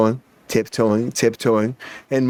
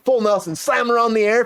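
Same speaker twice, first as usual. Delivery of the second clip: ordinary talk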